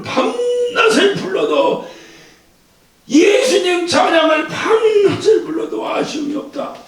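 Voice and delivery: high, drawn-out proclamation; fervent preaching. Acted. Preacher proclaiming endless praise, high and fervent. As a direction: lift it up with passion.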